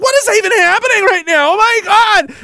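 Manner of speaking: high, loud panicked cry; terrified soldiers. Acted. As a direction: shriek it like soldiers who just lost their nerve. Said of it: "they were like".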